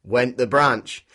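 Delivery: plain reading voice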